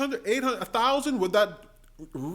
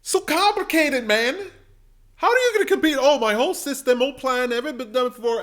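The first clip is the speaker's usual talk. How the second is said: high, loud exasperated whine; mock exasperated; 6 semitones higher, 6 dB louder than usual. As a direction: throw up your hands, overwhelmed.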